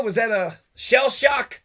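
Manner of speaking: ordinary delivery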